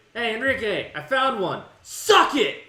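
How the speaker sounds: loud, high victory shout; triumphant yell. Retold or imitated. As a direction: yell it across the room. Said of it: "I yelled it across the pharmacy".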